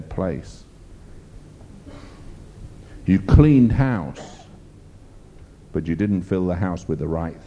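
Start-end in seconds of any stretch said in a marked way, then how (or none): 3.1-4.5 louder and higher, each word drawn out; preacher's slow hammer blow; slow emphatic. Real, not acted. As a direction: lean hard into the point, slowly.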